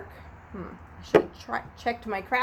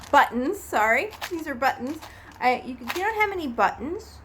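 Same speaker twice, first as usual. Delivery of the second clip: normal talking voice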